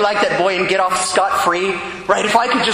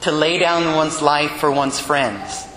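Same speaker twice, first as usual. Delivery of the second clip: solemn Gospel reading; 4 semitones lower than usual. Acted. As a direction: proclaim the Gospel steadily and reverently.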